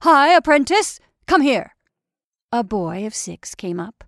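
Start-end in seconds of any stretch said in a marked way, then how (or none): 0.0-1.7 much louder, higher call; shouted. A narrator's reading. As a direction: summon the boy loudly. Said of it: "shouted Ardalian in the corridor".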